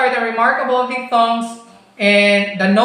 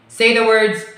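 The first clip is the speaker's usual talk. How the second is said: ordinary delivery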